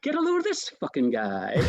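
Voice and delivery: chuckling through the line; amused aside; laughing quote. Retold or imitated. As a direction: say it while laughing.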